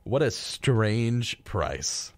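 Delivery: ordinary delivery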